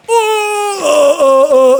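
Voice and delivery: high, whimpering "oh oh" of alarm; mock panic. Realizing the guy with the guns must stand up, whimpering in mock panic. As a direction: whimper in hammed-up fear.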